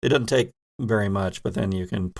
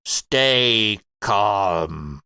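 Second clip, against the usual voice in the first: a lightly voiced alien, slow and calm; slow, even command from a creature; much slower than usual. Creature orders the player to remove mask, calmly.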